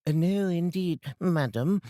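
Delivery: high, eager, agreeable; meek Mrs Dilber. Acted. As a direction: hurry to agree politely.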